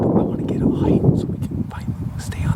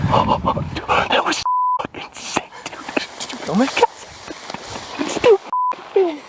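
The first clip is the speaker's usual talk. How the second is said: excited; 5 semitones lower than usual. Real, not acted. Hunter thrilled after the shot.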